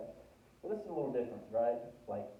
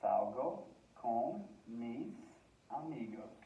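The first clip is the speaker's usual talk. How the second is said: Salgo con mis amigos spoken slowly, word by word; slow teaching read; slow careful demo; slower than usual. Shown as a demonstration. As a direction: spell it out slowly for learners. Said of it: "I would say".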